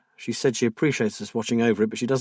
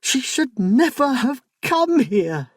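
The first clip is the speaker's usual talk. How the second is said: much higher, louder, frantic; near-scream of a frightened wife; almost screaming; 13 semitones higher, 4 dB louder, slower than usual. A narrator's reading. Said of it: "Wild-eyed, she almost screamed".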